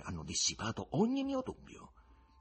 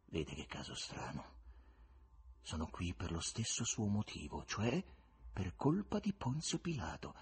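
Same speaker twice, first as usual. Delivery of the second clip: sad; 2 semitones lower, 8 dB quieter than usual. Acted. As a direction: speak from a closed-off sorrow. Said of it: "si chiuse nella sua tristezza".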